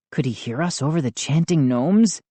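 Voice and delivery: ordinary delivery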